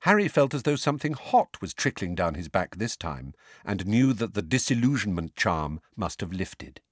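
ordinary delivery

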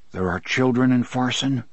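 usual speaking voice

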